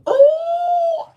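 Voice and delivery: high, loud gasping "Oh" of delight; excited yelp. Retold or imitated. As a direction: yelp out in sudden joy. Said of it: "I was like".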